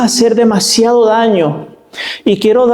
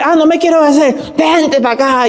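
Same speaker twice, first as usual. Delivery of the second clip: mock angry parent; 9 semitones higher than usual. Angry parent voiced in story.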